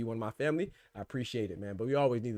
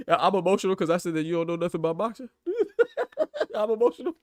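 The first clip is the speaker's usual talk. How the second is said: louder, words broken by real laughter; laughing in disbelief; 8 semitones higher, 7 dB louder than usual. Real, not acted. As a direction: laugh it off, amused by the insult. Laughs at a chat insult.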